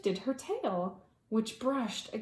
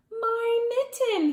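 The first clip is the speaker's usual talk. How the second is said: much higher, louder, slow glad cry; boy spots his lost mitten, high glad call; boy finding mitten; 13 semitones higher, 6 dB louder than usual. Acted. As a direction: cry out gladly, drawing it out. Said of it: "he said".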